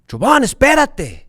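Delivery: put-on loud, high-pitched snap at a child; impatient parent's shout; yell. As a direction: yell sharply at your kid to wait. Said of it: "I yelled at him".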